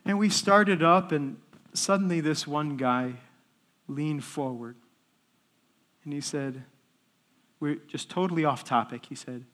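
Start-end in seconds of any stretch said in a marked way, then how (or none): none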